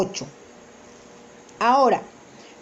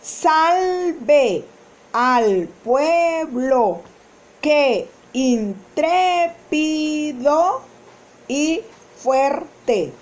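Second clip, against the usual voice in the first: slow verse reading; much slower than usual. Shown as a demonstration. Teacher reciting a hiatus example slowly for the class. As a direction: recite the verse slowly, each word apart.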